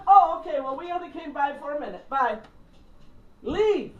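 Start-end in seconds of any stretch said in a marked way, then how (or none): 0.1-2.4 bright loud goodbye. Retold or imitated. Quick leave from mom.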